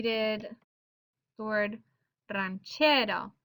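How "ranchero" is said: "ranchero" slowly, leaning on the "che"; stress on middle syllable. Shown as a demonstration. Teacher demonstrating the rule, "ranchero" stressed on second-to-last syllable. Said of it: "second to last syllable is getting that stress".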